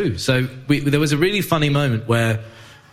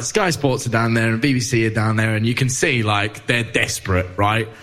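ordinary talk

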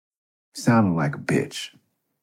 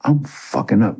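higher, agitated self-scolding; mildly raised frustrated rant; frustrated inner voice; 10 semitones higher than usual. Retold or imitated. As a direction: berate yourself in a panic. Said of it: "I was like".

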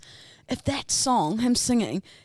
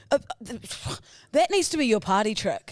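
ordinary talk

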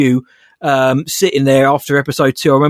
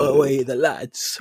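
slow, soft Geordie "Away the lads"; Geordie accent; 7 dB quieter, much slower than usual. Retold or imitated. Host mimicking the Geordie caller's accent, slow and soft. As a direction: draw it out like a northeast lad. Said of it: "What an accent".

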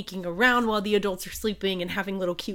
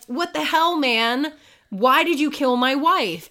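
higher, louder indignant accusation; mock outraged king; 5 semitones higher, 5 dB louder than usual. King accusing the other king of killing his wife, mock outrage. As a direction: play the wronged king, half-joking.

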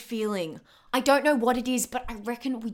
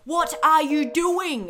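sharp, raised and slow; alarmed friend yells at her to stop the call; 4 semitones higher, 6 dB louder than usual. Acted. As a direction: stop her with a loud demand.

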